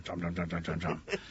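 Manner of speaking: low, soft run of chomps; munch the words down low; imitated chomping. Gator goes back to the tree, low chomping noises.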